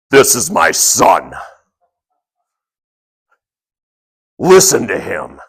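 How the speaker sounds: a hint of a deep, slow booming voice; slow, solemn God voice. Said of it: "this deep, loud, booming voice".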